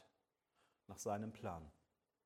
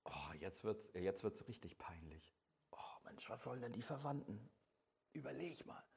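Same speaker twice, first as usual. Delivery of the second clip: hushed, half-whispered cringing aside; embarrassed murmur; 4 semitones lower, 11 dB quieter than usual. Acted. Funeral guest dreading what the relatives will think, in an embarrassed whisper. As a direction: mutter it under your breath, mortified.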